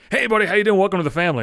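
lightly voiced hearty, loud hello; overfriendly bartender greeting. Bartender welcomes narrator like family, overfriendly.

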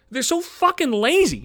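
angrier repeat. Real, not acted. Repeats the insult, louder.

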